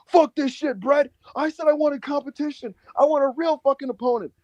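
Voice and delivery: high-pitched mock indignation; Cardona's angry rant. Cardona complaining after a quick win, high indignant mimicry. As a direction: imitate a wrestler griping for real competition.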